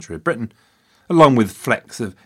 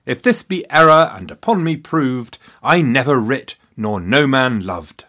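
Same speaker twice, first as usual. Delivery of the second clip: reciting Shakespeare; 4 semitones higher, 4 dB louder than usual. Shown as a demonstration. Quoting a sonnet to show lost rhymes, higher and louder recital. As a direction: declaim the couplet like a stage actor. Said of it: "For example".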